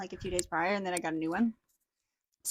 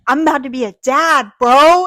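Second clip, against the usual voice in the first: loudly blurted news, full of pride; excited announcement; 4 semitones higher, 14 dB louder than usual. Retold or imitated. Boyfriend announcing to his firefighter crew, loud and excited. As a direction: burst in and shout it to the crew. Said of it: "this man walks in and he goes".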